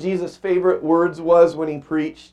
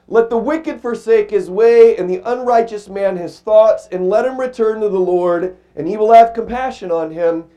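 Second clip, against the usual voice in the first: solemn scripture reading; 3 semitones higher, 4 dB louder than usual. Acted. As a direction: read it like a preacher proclaiming.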